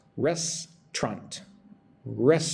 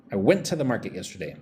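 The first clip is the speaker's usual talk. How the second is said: quick natural run; fast linked speech; 4 semitones lower, much faster than usual. Shown as a demonstration. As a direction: say it fast with words blended. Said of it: "I'm putting went to the together".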